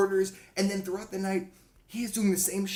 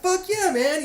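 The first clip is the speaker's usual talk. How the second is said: much higher, hyped-up cheer; mock bravado, louder and higher; pumped; 14 semitones higher, 6 dB louder, slower than usual. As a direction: yell it like a fired-up young band. Band hyped to accept drink.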